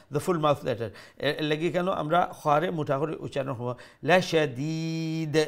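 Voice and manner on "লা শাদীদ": লা শাদীদ with a long drawn ee; slow recitation stretching the দী of শাদীদ; long madd. Shown as a demonstration. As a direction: hold the last vowel before stopping. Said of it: "মদ্দে আরিজ".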